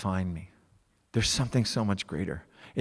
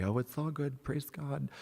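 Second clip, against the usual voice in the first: airy-fairy; 6 semitones higher, 6 dB quieter than usual. Retold or imitated. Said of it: "wasn't just airy-fairy".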